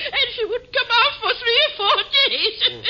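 high giggly words through laughter; giggling gossip. Acted.

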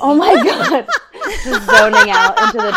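high laughing teasing. Real, not acted. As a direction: laugh it out at her. Laughing at cohost.

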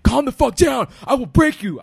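screaming threat at full force; furious shout pinning someone down; yelling. Retold or imitated. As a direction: roar it down at the thief. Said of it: "So I'm yelling, like".

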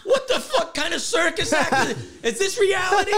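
high, laughing what-the-fuck; laughing disbelief. Retold or imitated. Teller laughing at the absurd fight scene.

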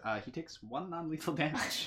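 usual speaking voice

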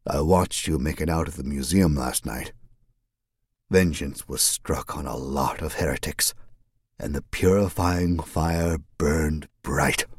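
ordinary delivery

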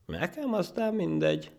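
very high, airy dismissal; superior. Acted. Monkey accepting a rematch, smugly superior. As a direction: smile condescendingly through it. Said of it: "mondta fölényes mosollyal a majom".

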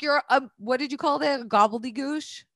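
usual speaking voice

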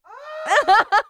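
high, loud scream of laughter; real burst of delighted laughter. Real, not acted. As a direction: shriek with delight. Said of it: "I was screaming in delight".

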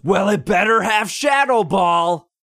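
high, slow, far-off shouted jab; a pushy raid guy mocked in a high, slow shout; mock demand. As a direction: bark it like a petty gatekeeper.

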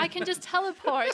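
snooty posh voice, laughing through it; smug upper-class boast with a laugh. Pretend. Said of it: "That's my posh teleportation voice".

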